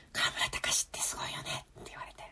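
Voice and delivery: hushed unvoiced whisper, barely audible. Real, not acted. Telling the cohost about an awkward moment, whispered. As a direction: breathe it out so the host can hardly hear. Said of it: "ウィスパーすぎ".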